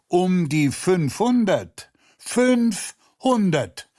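high, drawn-out repeat of the number, rising in intensity; emphatic exclamation. A narrator's reading. Aufgeregte Figur wiederholt die Zahl. (empörter, gedehnter Ausruf)

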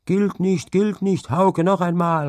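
high, loud protest; eager young men calling out high and loud. A narrator's reading. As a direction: shout it's void, do it over. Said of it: "riefen seine Partner".

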